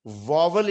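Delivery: واول as VOV-ul, a v in the middle; wrong pronunciation. Shown as a demonstration. Teacher showing students the mistake, mispronounced as VOV-ul. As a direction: pronounce واول "vo-vul" in two syllables. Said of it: "اس کا pronunciation نہیں ہے".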